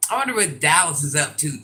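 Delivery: ordinary talk, nothing marked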